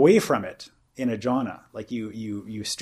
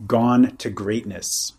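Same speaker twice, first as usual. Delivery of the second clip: slow, weighty "gone to greatness"; slow quoting; slower than usual. Shown as a demonstration. Teacher quoting a sutta phrase to his listener, slowly. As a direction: savour each word of "gone to greatness". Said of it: "a nice idiomatic phrase they use".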